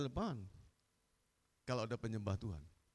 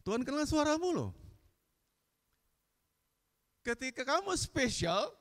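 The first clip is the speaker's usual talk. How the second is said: high, loud ringing sermon voice; fiery preaching; 13 semitones higher, 6 dB louder than usual. Acted. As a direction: preach it out with full force. Preacher's peak fervour.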